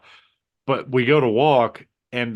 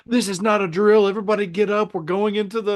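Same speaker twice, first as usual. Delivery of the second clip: imitated drill warning, pitched up; urgent announcement; 9 semitones higher than usual. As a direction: call out orders down the hallway. Somebody announces an emergency.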